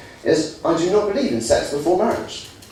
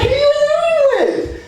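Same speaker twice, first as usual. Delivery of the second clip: put-on high, insistent girlfriend voice on the phone; nagging girlfriend, high and pushy. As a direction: play the demanding girlfriend, pitched way up.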